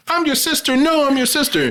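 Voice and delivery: very high, squealing mock plea; pleading sister. Captive sister begs, squeaky high mock plea. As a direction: cry it out like a desperate sibling.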